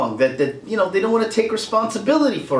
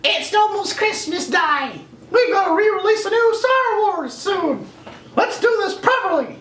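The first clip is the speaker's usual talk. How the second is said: much higher, eager put-on child voice; kid's reply. Street kid answering Mickey, high and eager. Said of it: "some kid says".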